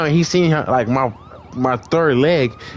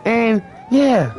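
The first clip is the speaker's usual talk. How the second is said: lightly voiced apologetic girlfriend; 9 semitones higher than usual.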